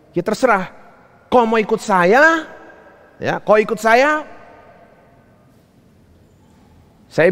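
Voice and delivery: high, pushed delivery of a husband's terms; retold ultimatum. Husband telling wife to follow or raise children alone, retold ultimatum. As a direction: lay down the terms, imitating a husband.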